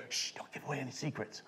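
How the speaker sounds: very soft, secretive hush; conspiratorial shush. Retold or imitated. Magician hushing actress at his card table, conspiratorial. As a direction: shush her under your breath.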